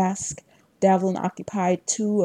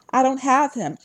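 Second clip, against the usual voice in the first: higher, louder urgent yell; 7 semitones higher, 5 dB louder than usual. A narrator's reading. Narrator warning the team as the host lunges, yelling. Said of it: "I yelled".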